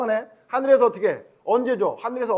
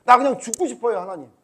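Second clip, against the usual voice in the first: higher, louder weary plea; Moses despairing; 5 semitones higher, 8 dB louder than usual. Retold or imitated. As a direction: sigh out a tired plea. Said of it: "이런 얘기까지".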